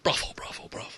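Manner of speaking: breathy whispered chant; whispering. Pretend. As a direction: whisper the word like a secret.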